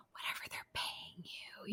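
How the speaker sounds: hushed, fully whispered movie quote; whispering. Retold or imitated. Film fan quotes a character negotiating with his killer, whispering. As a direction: whisper it as the character pleading quietly. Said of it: "he's whispering".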